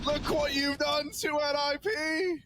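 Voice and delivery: high, thrilled exclamation with a laugh; amazed outcry. Real, not acted. Caster marvels at what the player did, high amazed outcry. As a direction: cry out high, breaking into laughter.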